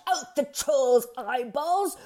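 high, slow, grand goat threat; theatrical announcement. A narrator's reading. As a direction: declare it slowly with full melodrama. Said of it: "theatrically announced his intentions".

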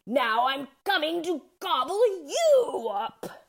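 high, theatrical troll voice; troll threat. A narrator's reading. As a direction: threaten to devour them as the troll. Troll's threat acted once more.

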